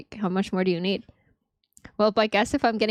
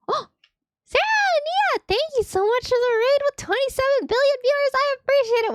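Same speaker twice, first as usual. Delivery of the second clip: high excited thank you. Real, not acted. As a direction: squeal thanks for the raid.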